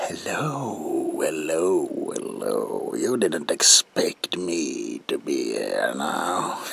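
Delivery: ordinary talk, nothing marked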